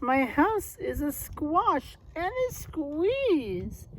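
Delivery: put-on high, pleading old lady voice. Old lady begging the wise man for help, pleading. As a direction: beg like a fussy little old lady. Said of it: "The little old lady cried".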